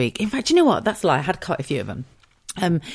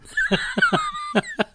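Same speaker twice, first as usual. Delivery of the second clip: real high laugh at herself; high squealing laugh. Real, not acted. To listeners after joking about kissing, high giggle.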